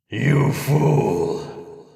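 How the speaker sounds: hollow, unearthly voice, slow on the last line; mock the living from the grave; a hint of a hollow, disembodied voice; inhuman voice. A narrator's reading. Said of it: "this voice was deep, hollow ... inhuman".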